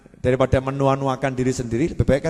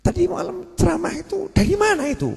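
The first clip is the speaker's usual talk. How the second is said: mock teacher's question. Meniru guru bertanya.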